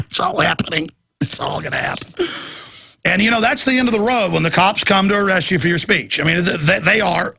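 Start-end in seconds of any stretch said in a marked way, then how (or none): 0.1-2.3 unvoiced ominous whisper; hushed whisper. Real, not acted. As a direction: breathe it like a secret warning. Whispered aside.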